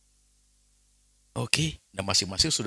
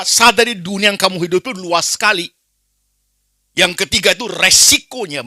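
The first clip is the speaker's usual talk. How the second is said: much higher, much louder fiery sermon shouting; full-force preaching; 12 semitones higher, 12 dB louder than usual. Acted. Preacher rebuking uncaring families, full-force shouting. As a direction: thunder it at the congregation.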